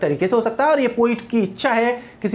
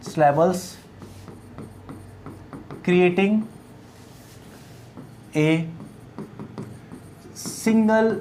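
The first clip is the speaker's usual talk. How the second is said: very slow, word-by-word dictation; lower-pitched, isolated words left hanging for note-taking; 3 semitones lower, 6 dB quieter, much slower than usual. Shown as a demonstration. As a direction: dictate to a class, long pauses between words. Teacher dictates a definition.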